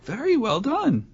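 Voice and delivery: soft, high, slow congratulation; gently applaud in a put-on dainty voice; mock-refined praise.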